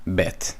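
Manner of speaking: Bet ending on a crisp hard t, no th. Shown as a demonstration. Teacher showing the modern way to say Bet. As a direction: snap Bet shut with a plain t. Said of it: "is how people say it now".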